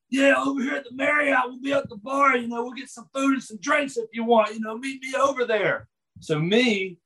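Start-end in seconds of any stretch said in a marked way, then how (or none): 0.1-5.9 Michael Hayes invite. Retold or imitated. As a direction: shout the invite like a cocky wrestler. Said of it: "Michael's literally like".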